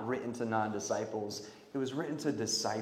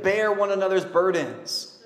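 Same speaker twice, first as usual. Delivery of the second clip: ordinary talk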